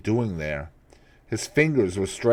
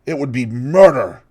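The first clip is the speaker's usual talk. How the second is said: horrified plea; 5 semitones higher, 9 dB louder than usual. Acted. Dorian horrified.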